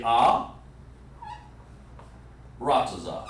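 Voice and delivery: held "ah", long pause, then "ra, taza"; "ah" left hanging, then "ra, taza" falling; split pronunciation. Shown as a demonstration. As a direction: separate "ah" from "ra, taza". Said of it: "you can say it like this".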